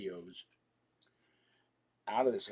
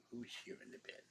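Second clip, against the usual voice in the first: unvoiced whisper to a cat in bed; 13 dB quieter, faster than usual. Real, not acted. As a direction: softly whisper to the pet. Talking to cat softly.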